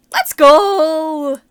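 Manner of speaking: loud, high victory cheer; a loud hyped shout; excited cheer. Real, not acted. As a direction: celebrate the find out loud. Finds her flowers in game.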